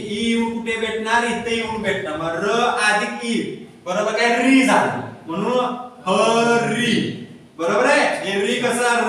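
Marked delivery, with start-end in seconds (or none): 6.1-7.2 ह and री drawn out very slowly, separately; slow teacher's syllable-by-syllable ह री; sounding out syllables. Shown as a demonstration. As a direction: stretch ह, then री, for learners.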